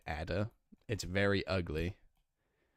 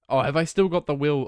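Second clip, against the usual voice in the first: sudden realization; 8 semitones higher, 11 dB louder than usual. Real, not acted. Suddenly remembers forgotten item.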